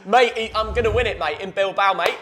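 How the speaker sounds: loud, high put-on Australian accent for Ange; hammed-up Australian accent, mate-heavy; Ange impression. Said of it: "What, what accent is that".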